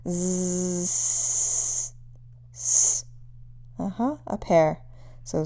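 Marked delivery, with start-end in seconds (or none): 0.1-1.9 "Z" with the voice taken away, just air; voiceless z. Shown as a demonstration. Teacher removing voice from z for students. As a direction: drop the voice from "Z". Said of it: "take your voice away".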